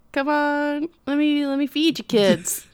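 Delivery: hammed-up old rancher impression; old rancher grandpa. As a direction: coax them in like a folksy old man. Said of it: "And he's like".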